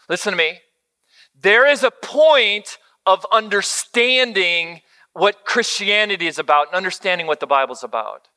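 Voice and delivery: usual speaking voice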